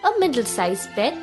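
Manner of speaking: plain reading voice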